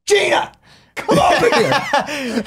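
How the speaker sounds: very high, excited mock shout; imitated calling out. Guest imitates a Chicago fan calling a friend over, excited mock shout. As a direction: holler for a companion as a comic impression.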